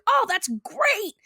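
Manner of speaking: high, drawn-out gushing praise; encouraging. Retold or imitated. Hank praising Peggy's therapy effort, encouraging. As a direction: cheer someone on, stretched out high. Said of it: "He's so encouraging".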